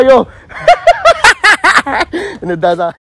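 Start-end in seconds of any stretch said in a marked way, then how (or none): 0.5-2.4 burst of laughter. Real, not acted. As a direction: laugh hard at the prank victim. Prankster laughs after the joke.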